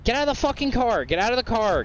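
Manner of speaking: high, fast exasperated order; yelling at a stubborn NPC; frustrated yell. Real, not acted. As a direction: bark it at the NPC. Shouting at NPC to exit car.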